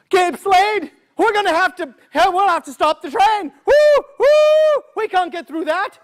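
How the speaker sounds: high frantic engineer plea; panicked engineer. Acted. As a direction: beg to stop the train.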